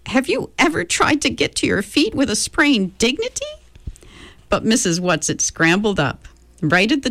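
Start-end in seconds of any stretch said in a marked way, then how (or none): 0.1-3.5 higher, mock-offended old lady; huffy old lady from the floor; lightly voiced prim old woman; indignant old woman. Mrs Whatsit on the floor.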